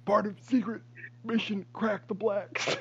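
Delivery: high, slow put-on voice; mock villain politician. Friend keeps the politician bit going slowly. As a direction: drawl out the codename.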